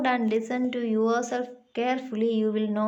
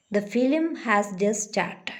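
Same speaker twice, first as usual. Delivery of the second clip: "started" falls away at the end; falling statement tone. Shown as a demonstration. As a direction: read it as a statement, dropping on "started". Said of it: "and in answers".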